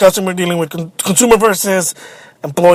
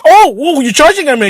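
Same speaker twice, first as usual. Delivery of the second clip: high-pitched, lightly imitated startled protest; mock alarm; 7 semitones higher, 3 dB louder than usual. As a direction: act out someone backing off, mildly alarmed. Imitates manager's alarm at being approached.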